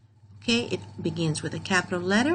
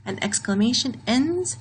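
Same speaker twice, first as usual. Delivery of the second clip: ordinary talk, nothing marked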